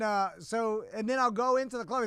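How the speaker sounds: normal talking voice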